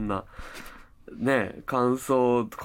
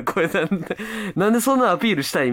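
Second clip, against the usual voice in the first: mock disbelief; 6 semitones higher than usual. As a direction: act out a baffled recipient. Imagines recipient's baffled reaction.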